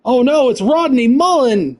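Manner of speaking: high, slow, hammed-up dismay; playfully panicked outcry; mock alarm. Rival skater appears in game.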